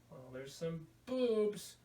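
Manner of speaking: usual speaking voice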